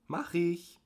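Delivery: high, sing-song sweet reply; fluting. A narrator's reading. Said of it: "flötete sie".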